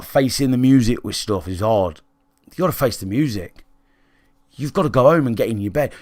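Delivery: ordinary talk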